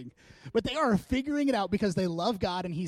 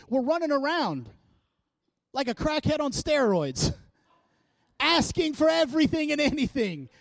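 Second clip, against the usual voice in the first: higher, louder frantic rush of worries; panicked prayer; 10 semitones higher, 5 dB louder than usual. Acted. Preacher acting out a panicked believer begging God, higher and louder. Anxious praying person acted out.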